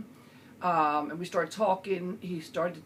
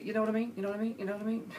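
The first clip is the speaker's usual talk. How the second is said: usual quick catchphrase; 6 semitones higher, much faster than usual. Retold or imitated. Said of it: "I always say".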